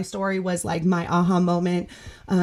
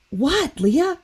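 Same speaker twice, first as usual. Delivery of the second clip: retold mother; 6 semitones higher than usual. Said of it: "and she's like".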